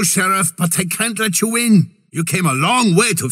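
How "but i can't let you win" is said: ordinary delivery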